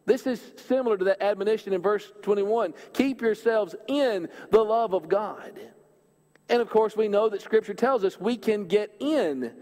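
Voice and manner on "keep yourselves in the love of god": slow, with "in" pressed and lengthened; "in" held long in a slow quote; stress on in. Shown as a demonstration. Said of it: "Emphasis on the word in".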